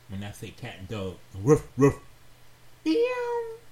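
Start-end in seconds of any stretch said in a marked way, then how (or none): 1.3-1.9 loud barking impression; dog bark. Imitating dog to make pets react. 2.8-3.6 very high squeaky meow; cat meow. Retold or imitated. Imitating cat to make pets react.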